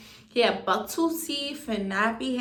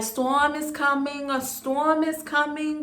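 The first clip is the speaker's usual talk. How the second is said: higher, louder drawn-out warning call; neighbors calling warning; 6 semitones higher, 5 dB louder than usual. Retold or imitated. Neighbors warning the barrio whenever thunder comes, called out high and slow. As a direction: call out the warning slowly, voice raised.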